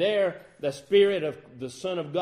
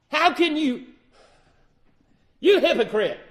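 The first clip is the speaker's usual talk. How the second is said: raised, scornful challenge, climbing higher and louder on "how can you"; mock accusing heckler; 4 semitones higher, 4 dB louder than usual. Imagined critic jeering at Peter, calling him hypocrite, loud and scornful. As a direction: needle the preacher as a hypocrite.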